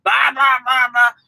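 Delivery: mock Boston yelling, pitched high; loud talking. Trucker showing listeners how Bostonians talk, high loud mock yelling. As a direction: jabber loudly like a mock shouter. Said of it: "They're talking loud".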